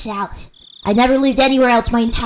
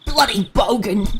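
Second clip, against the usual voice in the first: game character impression. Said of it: "T F two voices".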